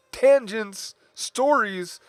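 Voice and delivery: high, loud mock announcement; self-teasing high call; playful self-mockery. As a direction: call each word out high, teasing yourself. Mocks own habit of tangents.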